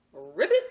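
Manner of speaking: loud playful ribbit croak; frog croak ribbit. Acted. Boy pretending to be a frog, loud ribbit.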